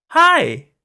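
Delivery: high, loud, chirpy "Hi!"; bubbly feminine greeting. Acted. An eerie voice greeting the narrator from nowhere, bubbly and high. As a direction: chirp it like a bright young woman.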